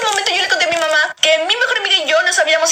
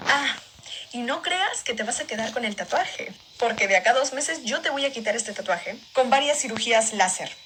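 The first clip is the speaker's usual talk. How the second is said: lower, softer voice of a threatening mother; a hint of a mom menacing her daughter, low and quiet; angry mom; 7 semitones lower, 7 dB quieter than usual. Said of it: "ella me decía".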